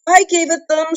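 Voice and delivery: high, frightened little squeak. A narrator's reading. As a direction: peep it out in a dreadful fright. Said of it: "squeaked Bilbo".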